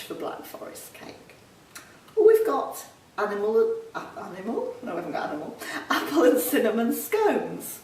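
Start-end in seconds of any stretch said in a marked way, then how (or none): none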